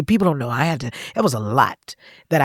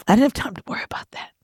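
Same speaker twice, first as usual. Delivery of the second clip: tearful whisper; 7 semitones higher, faster than usual. Real, not acted. Grieving brother, about to cry.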